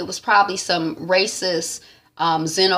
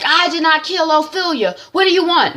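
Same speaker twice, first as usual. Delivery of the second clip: higher, louder protest; desperate denial; 11 semitones higher, 5 dB louder than usual. Retold or imitated. Nick denying he killed Ophelia, high loud protest. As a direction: push back hard, pleading innocence. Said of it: "Nick is like".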